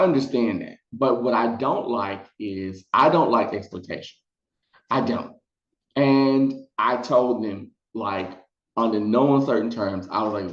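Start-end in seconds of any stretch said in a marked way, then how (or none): none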